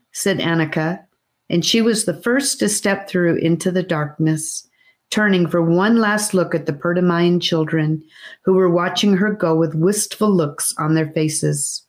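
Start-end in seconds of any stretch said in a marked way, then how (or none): none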